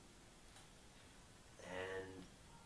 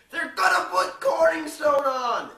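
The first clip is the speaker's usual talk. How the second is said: much higher, much louder, drawn-out shout; slow furious yell; 20 dB louder, slower than usual. Retold or imitated. Ron yelling the news at everyone, slow and much louder. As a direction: scream it slowly across the office.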